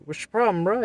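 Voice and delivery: loud, high retold demand; confrontational challenge. Driver confronts man leaving the store, challenging.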